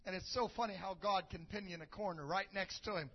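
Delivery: ordinary talk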